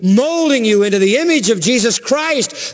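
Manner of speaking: high-pitched, insistent preacher's delivery; impassioned preaching. Acted. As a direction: drive each phrase home from the pulpit, pitch held high. Preaches at full force.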